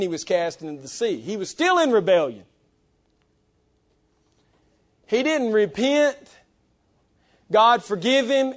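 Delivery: emphatic pulpit delivery; forceful preaching. Real, not acted. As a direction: drive the point home to the congregation.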